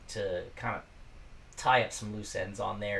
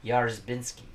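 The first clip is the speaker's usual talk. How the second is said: ordinary talk, nothing marked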